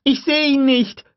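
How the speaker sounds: put-on very high, loud girl's cry; panicked scream. A narrator's reading. Lex screaming at Tim in the dark, panicked scream. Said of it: "schrie Lex".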